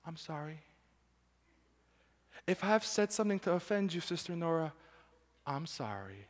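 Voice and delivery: soft, hollow mock apology; hushed insincere sorry. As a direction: murmur a fake sorry to hide.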